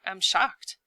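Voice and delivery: plain reading voice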